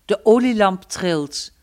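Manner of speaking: ordinary delivery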